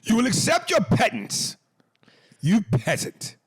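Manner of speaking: high, loud pompous lord voice; mock royal decree. Mocking the guy, hammed-up lordly order. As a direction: proclaim it like a haughty king.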